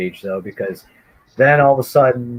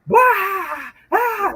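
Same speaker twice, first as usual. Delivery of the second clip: mock laughing yelp. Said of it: "They're both laughing".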